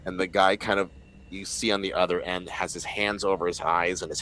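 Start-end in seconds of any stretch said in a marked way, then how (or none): none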